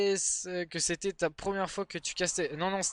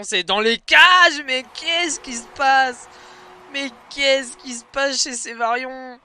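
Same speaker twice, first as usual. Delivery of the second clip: higher, louder goal shout; excited goal call; 8 semitones higher, 12 dB louder, slower than usual. Acted. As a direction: yell the goal in disbelief. Goal called in live match.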